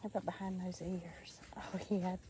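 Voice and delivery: whispered coo; whispering. Real, not acted. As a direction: whisper it softly. Petting animal.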